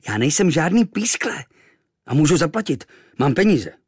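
put-on mild boyish indignation; defending himself. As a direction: stand up for yourself. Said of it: "Bránil jsem se".

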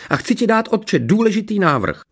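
very high, eager declaration; insistent princess. A narrator's reading. Princess proposing something to her father, insistent. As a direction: push on in a high voice.

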